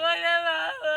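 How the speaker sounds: loud drawn-out howl; theatrical held-vowel lament; demonstrative crying. Shown as a demonstration. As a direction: wail it out in exaggerated grief. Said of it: "কেমন করে কান্দিবে".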